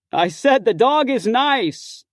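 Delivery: loud, high shout across the yard, slowly; bellowing. Acted. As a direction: bellow it from the doorway. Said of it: "tattooed god guy bellows".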